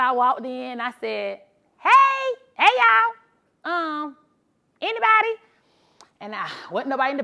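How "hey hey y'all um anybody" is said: high, loud call into an empty store. Retold or imitated. Driver calling for staff in empty store, loud high call. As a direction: call out for anyone in the back.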